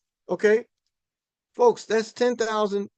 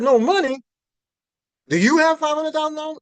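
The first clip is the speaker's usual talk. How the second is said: higher, indignant mock outburst; upset retort; 8 semitones higher, 4 dB louder than usual. Retelling the brother's reply, an indignant pitched-up retort. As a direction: play the offended brother snapping back, pitched up. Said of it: "did he get upset".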